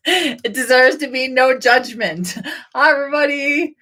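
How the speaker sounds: high, laughing delivery; tickled and high-voiced; amused delight. Real, not acted. Delighted realisation, laughing as she speaks.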